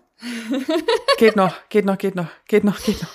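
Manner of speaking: holding back a sneeze; fighting the urge to sneeze; about to sneeze. Real, not acted. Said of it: "Ich muss niesen". (kurz vorm Niesen)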